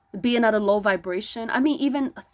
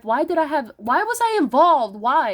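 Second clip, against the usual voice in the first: raised voice, higher and louder; frustrated yelling; 3 semitones higher, 4 dB louder than usual. Real, not acted. Looking back on their own life, frustrated yelling. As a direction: cry out in exasperation at yourself. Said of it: "I'm sorry for yelling".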